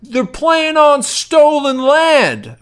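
very slow, high preachy heckle; imitating the heckler. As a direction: lecture it out like a smug heckler. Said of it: "He's like".

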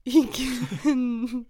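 loud amused repeat. Real, not acted. Repeats funny title loudly.

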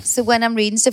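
ordinary delivery

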